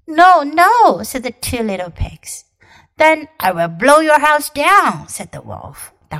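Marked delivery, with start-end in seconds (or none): 0.1-1.0 high, loud little pigs. Acted. Two pigs refusing. 3.0-5.1 loud big bad wolf. Wolf threatening.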